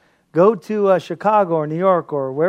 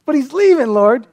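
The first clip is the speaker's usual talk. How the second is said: pleading to God; 9 semitones higher, 7 dB louder than usual. Retold or imitated.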